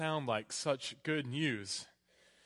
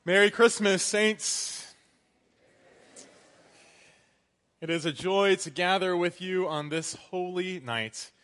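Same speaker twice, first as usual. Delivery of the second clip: loud, ringing Christmas sermon voice; preaching; 8 dB louder than usual. Acted. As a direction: proclaim it from the pulpit. Christmas sermon opening at full force.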